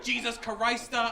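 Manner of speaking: "Christa" stretched with an added syllable; extra syllables. Retold or imitated. Grandfather losing it at price, "Christa" with extra syllable. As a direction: draw out "Jesus Christa" slowly, padding the syllables. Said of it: "putting more syllables into words".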